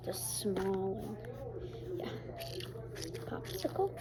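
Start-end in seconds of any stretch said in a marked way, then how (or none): 0.1-2.1 whisper. Real, not acted.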